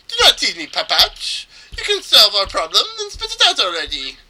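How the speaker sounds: put-on high, loud Spanish-accented demand; impatient mock Spanish accent. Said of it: "I think it's supposed to be Spanish".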